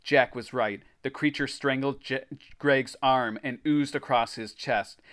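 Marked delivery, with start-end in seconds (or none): none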